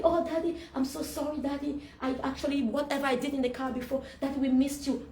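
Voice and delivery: lightly voiced eager daughter, pitch lifted; excited child. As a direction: pour it out like a child running to Dad. Child rushes to hug Daddy, apologizing.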